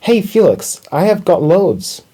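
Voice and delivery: high-pitched little mouse calling; calling out eagerly. A narrator's reading. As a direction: call to a friend, pitched well up. Little mouse calls down to Felix.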